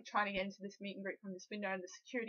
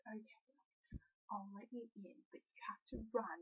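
secret whisper; 9 dB quieter than usual. Retold or imitated. Guard secretly lets her in.

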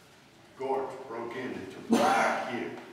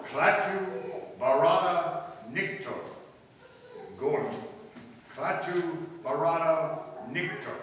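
each word spelled out slowly; slow careful demo; 3 semitones higher, slower than usual. Shown as a demonstration. Klaatu teaching Helen the phrase, slow and careful. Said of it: "Say it".

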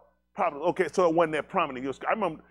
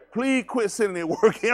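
loud, higher mock plea to the teacher; 5 semitones higher, 7 dB louder than usual. Teacher begging the student to stop, mock loud plea. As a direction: call out like a fed-up teacher begging. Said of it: "Them folk be like".

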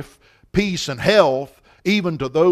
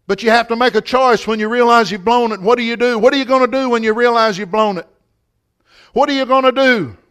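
raised preacher's voice, pressing the question; challenging preaching; 6 semitones higher, 6 dB louder than usual. Real, not acted. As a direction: put the challenge hard to the congregation.

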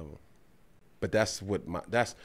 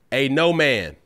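loud announcement; 3 semitones higher, 9 dB louder than usual. Real, not acted. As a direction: punch it out loud. Announcing the concept.

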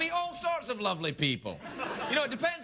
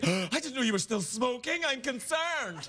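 higher voice, slowing dramatically at the end; hammed-up shocked concern; mock-concerned boss; 5 semitones higher than usual. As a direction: play the boss aghast with exaggerated concern. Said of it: "What's he gonna say?".

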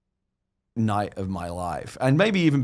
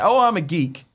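mocking poser; 6 semitones higher, 4 dB louder than usual. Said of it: "posers like".

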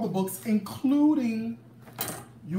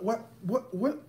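ordinary talk

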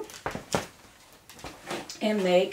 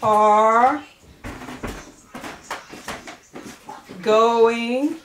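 one loud word at a time; dictation pace; 3 dB louder, much slower than usual. Shown as a demonstration. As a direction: call each word out, slowly. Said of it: "put our words on top of the lines".

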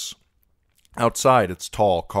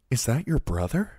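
put-on lightly raised girlish voice, curious; young girl's voice; 7 semitones higher than usual. A narrator's reading. Scene: Sansa asks Sir Loras about his brother, girlish voice.

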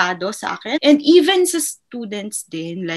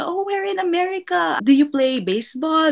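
high, eager principal asking questions; quoting the principal; 8 semitones higher, 3 dB louder than usual. Retold or imitated. Principal warmly quizzing the American teacher, higher and interested. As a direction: voice the keen principal, pitch up. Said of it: "Kasi yun siya tinanong".

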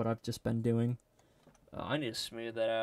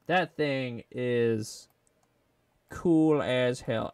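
slow admiring; 5 dB louder, much slower than usual. Real, not acted. Admiring the sculpt.

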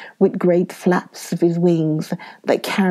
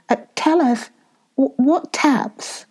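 eager question; 7 semitones higher than usual. A narrator's reading. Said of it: "cried Effie".

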